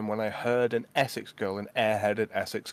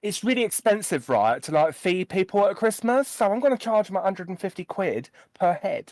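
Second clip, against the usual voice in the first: put-on higher, louder Essex accent; 9 semitones higher, 6 dB louder than usual. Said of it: "Is that Essex?".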